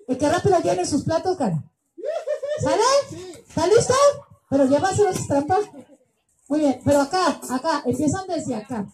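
ordinary talk

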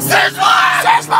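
shouted, breathy, giddy cheer; excited squeal. Real, not acted. Chanting the restaurant name excitedly.

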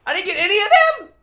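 can't believe you missed them all; outraged disbelief. Real, not acted. Gamer outraged at missed shots, loud disbelief.

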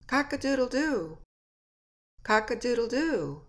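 lightly imitated rooster crow; high crow that falls away at the end. Shown as a demonstration. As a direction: call it out like a rooster. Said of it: "here, said the rooster".

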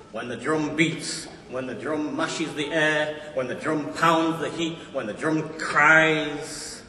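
measured, emphatic spoken-word delivery; poem recitation. Acted.

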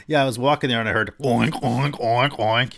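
loud, hammed-up pig noises; acting out oinks. Shown as a demonstration. Teacher demoing a crude teller with animal noises, loud and hammed-up. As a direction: oink loudly like a crude teller. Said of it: "Or would they say".

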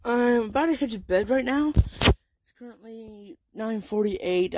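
ordinary delivery

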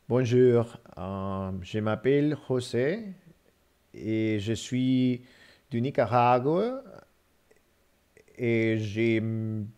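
slow, halting French with an English accent; feed the phone one careful phrase at a time; broken French. Shown as a demonstration. Retrying French dictation to the phone, slow and halting. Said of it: "We're gonna try one more time".

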